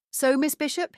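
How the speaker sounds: light Irish lilt on the detective's greeting; lightly voiced Irish accent; thick Irish accent. A narrator's reading. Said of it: "he said in his thick Irish accent".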